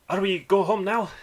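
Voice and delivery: put-on high, loud little-boy voice; a small child piping up to his father; young dwarf boy. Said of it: "like a young person's voice".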